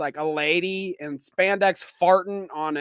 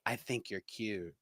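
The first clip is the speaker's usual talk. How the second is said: low, soft, slow come-on; mock flirty line; 9 semitones lower, 16 dB quieter, slower than usual. Nerd hitting on the host, low soft mock flirt. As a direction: purr it low and slow as a joke. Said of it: "he's like".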